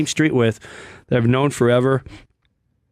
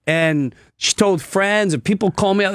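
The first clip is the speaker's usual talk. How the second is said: normal talking voice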